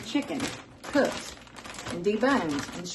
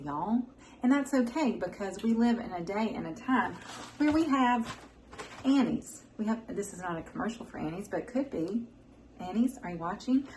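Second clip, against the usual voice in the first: normal talking voice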